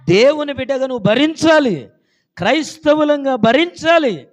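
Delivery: high, very loud pulpit command; loud preaching cry; shouted exhortation. Real, not acted. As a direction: urge the congregation with full force. Exhorts congregation at full force.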